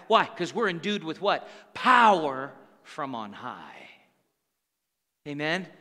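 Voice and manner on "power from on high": POWER drawn out, then dropping low and slow; emphatic answer. Real, not acted. Preacher answering what we're endued with, weighty emphasis. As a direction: stretch "Power" and settle deep on the rest.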